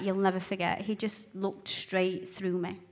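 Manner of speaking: usual speaking voice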